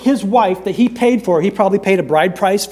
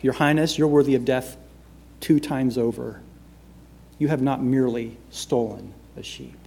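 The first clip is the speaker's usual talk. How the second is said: grave, quiet warning; 5 semitones lower, 6 dB quieter than usual. Retold or imitated. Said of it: "maybe I would do it this way".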